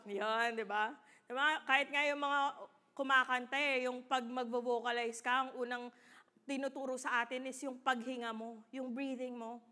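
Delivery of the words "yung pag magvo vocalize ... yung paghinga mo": ordinary talk, nothing marked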